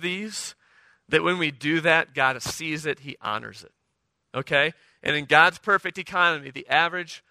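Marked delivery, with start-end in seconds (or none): none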